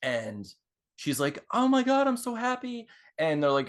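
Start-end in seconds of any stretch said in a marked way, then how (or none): 1.5-2.9 high-pitched, hammed-up joy; freaking-out fangirl. Fan invited backstage by the band, squealing with joy. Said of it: "she's freaking out".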